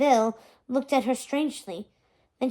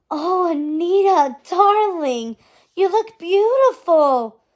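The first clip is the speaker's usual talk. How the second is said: higher, louder, slow gushing mother; Mum gushing; 5 semitones higher, 6 dB louder, slower than usual. Acted. Mother gasping at her daughter in diamonds, higher and louder. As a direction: swoon over her daughter, drawing it out high.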